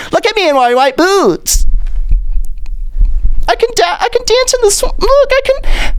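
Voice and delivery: very high, show-off squeal; mock DeSantis.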